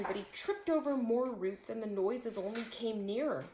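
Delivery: ordinary delivery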